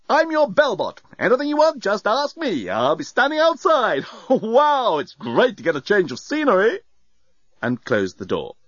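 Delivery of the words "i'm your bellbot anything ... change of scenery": high-pitched, cheery bellbot; breezy robot. A narrator's reading. Bellbot introduces itself on leaving, breezy and high. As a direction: chirp it with breezy enthusiasm. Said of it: "it said breezily".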